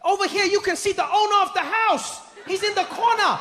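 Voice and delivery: very high, gleeful tour guide; overexcited guide's announcement; Deng Basan. Acted. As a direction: proudly point out the exhibit, pitched high. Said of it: "he turns and he's like".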